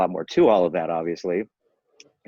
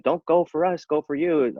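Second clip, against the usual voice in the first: much higher, sing-song mocking of the advice; mocking a foolish comment; 10 semitones higher, 3 dB louder, slower than usual. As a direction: parrot the silly line in a high voice. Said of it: "this foolish comment".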